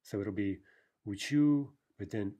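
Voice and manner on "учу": у-ЧУ, higher and louder; end stress on учу. Shown as a demonstration. Teacher showing the first person, учу stressed on ending. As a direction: punch the final syllable of учу. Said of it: "shifting stress".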